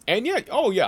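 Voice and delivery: excited outburst. Acted.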